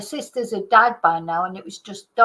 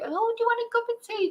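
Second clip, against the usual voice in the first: put-on much higher, fast, eager old lady; imitating Dorothy pressing tea on a visitor; old lady offering tea; 13 semitones higher, 4 dB quieter, faster than usual.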